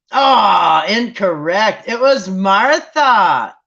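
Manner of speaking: loud, slow, drawn-out game-show reveal, "incorrect" stretched; surprised reveal. Real, not acted. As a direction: announce the wrong answer like a stunned quiz host. Game reveals wrong answer.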